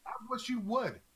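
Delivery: high mocking dare; daring taunt. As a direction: dare him to try. Prisoner taunts the guard.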